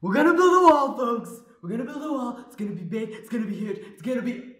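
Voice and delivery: high, loud Trump rally voice; Trump impression. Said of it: "Lefa Trump".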